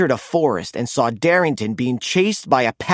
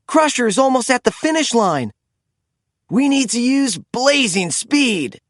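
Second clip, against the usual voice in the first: urgent excited call; 8 semitones higher than usual. A narrator's reading. AJ urges during the race.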